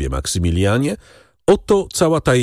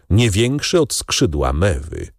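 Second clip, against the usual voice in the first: ordinary delivery, nothing marked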